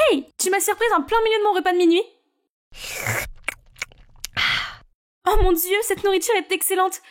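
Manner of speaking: put-on teen girl voices. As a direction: chatter and complain like a hungry teen.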